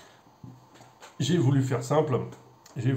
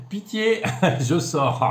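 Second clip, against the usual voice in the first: laughing at his own pun; 5 semitones higher, 3 dB louder, slower than usual. Real, not acted. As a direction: giggle through it, embarrassed. Laughs at own bad joke. (rire gêné de sa propre blague)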